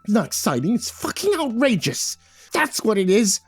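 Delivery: high-pitched, scornful outrage; irate little leprechaun voice; shouting leprechaun. A narrator's reading. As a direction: spit it out high, thoroughly outraged. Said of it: "The leprechaun shouted".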